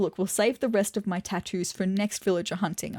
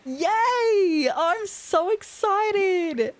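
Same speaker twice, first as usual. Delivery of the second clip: excited cheer; 10 semitones higher, much slower than usual. Real, not acted. Player celebrates getting her dream villager, cheering excitedly. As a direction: shout for joy and stretch it out.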